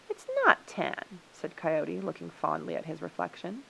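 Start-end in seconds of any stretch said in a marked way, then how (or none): 0.1-1.2 high, slow, huffy denial; stretched-out high protest; offended correction. A narrator's reading. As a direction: correct them as if insulted. Coyote offended about his suit.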